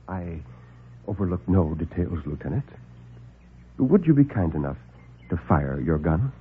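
low, soft, smooth doctor; calm the men down, unhurried and superior; suave doctor. Acted. Doctor soothes the alarmed lieutenant, low and suave.

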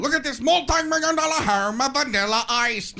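high, hammed-up TV host impression; Robin Leach. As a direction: announce it like a showy celebrity-show host. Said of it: "Robin Leach isn't laughing when he says".

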